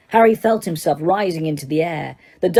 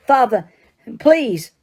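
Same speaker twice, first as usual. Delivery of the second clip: desperate plea, higher; pleading son; 5 semitones higher, slower than usual. Acted. Son in chains begging his father, frightened. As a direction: beg your father for mercy. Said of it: "said the boy".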